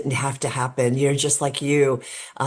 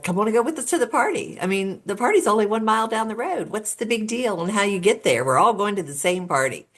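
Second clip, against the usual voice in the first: higher, quick, imitated calling out to a friend; retold coaxing yell; 6 semitones higher, faster than usual. Boy urges his friend Logan into the car, mild mock yell. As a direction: imitate a teen talking a pal into coming along. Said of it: "he yelled to his friend Logan".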